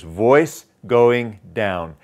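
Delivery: very slow, DOWN falling lowest; drawn-out musical descent to DOWN; stepping down like notes. Shown as a demonstration. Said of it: "just like musical notes".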